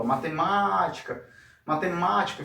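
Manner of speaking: "matemática" called out with "má" held long; calling out. Shown as a demonstration. Teacher showing where the accent falls, "matemática" called out with long "má". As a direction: call "matemática" like a name, stretching "má". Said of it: "como se estivesse chamando".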